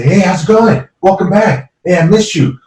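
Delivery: lightly voiced restaurant owner giving a cheerful welcome; welcoming owner. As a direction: greet every guest like family. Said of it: "He'll shake your hand, say".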